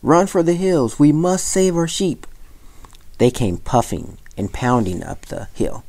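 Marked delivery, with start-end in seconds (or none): 0.0-2.3 high, urgent rallying cry; alarmed calling out. A narrator's reading. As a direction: rouse the village to run. Villagers raise the alarm.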